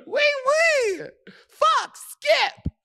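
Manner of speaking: very high squealed oui oui; squeaky mock French. Friend squeals fake French way up high, laughing. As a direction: squeak it way up in falsetto. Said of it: "fake French".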